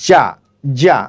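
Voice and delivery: "জা" pressed firmly against the hard palate. Shown as a demonstration. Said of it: "উচ্চারিত হবে শক্তভাবে". (তালুতে লাগিয়ে শক্তভাবে "জা")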